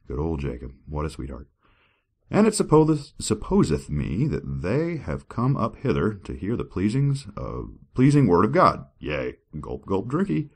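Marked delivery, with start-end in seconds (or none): 2.3-8.9 grand, high dramatic reading; scripture reading. Acted. 9.6-10.5 silly drinking cue. Shown as a demonstration. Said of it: "drinky was a little bit corny".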